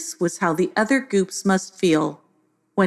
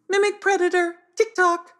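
high, mocking squawk; high birdlike taunt; taunting parrot; 13 semitones higher than usual. A narrator's reading. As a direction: taunt them like a cheeky bird. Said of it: "as if he were taunting them".

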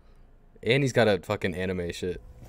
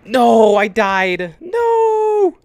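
high, loud wail of defeat; anguished cry; 13 semitones higher, 9 dB louder, much slower than usual. Real, not acted. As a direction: cry out at dying. Player just died in game.

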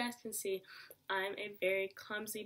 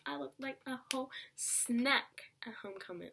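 breathy stage whisper, showing off; a hushed, proud brag; sassy whisper aside; 4 semitones higher than usual. Real, not acted. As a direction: whisper it like a cheeky secret. Sassy aside about her looks.

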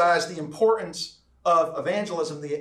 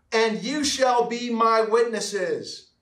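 slow, raised, measured reading; emphatic scripture reading; 4 semitones higher, much slower than usual. Acted. Preacher reads Christ's final words to church, slow and emphatic. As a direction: let each word of the verse land slowly.

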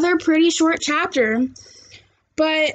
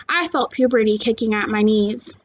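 plain reading voice

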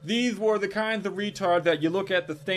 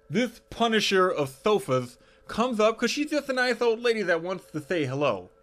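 ordinary delivery

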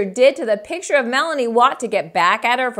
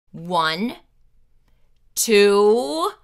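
stern count; 5 dB louder, much slower than usual. Acted. Narrator counts to three as warning.

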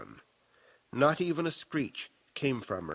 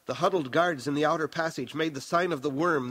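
plain reading voice

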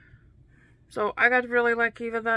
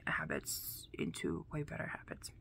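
whisper; 4 semitones lower, 13 dB quieter than usual. Real, not acted. Whispering about habits.

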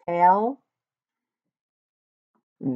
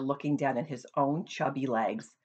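ordinary delivery

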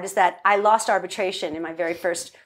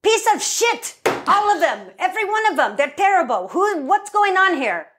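high-pitched ranting producer impression; Avi Arad; 11 semitones higher than usual. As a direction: rant with no filter, imitating a disgusted boss. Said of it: "Avi was like".

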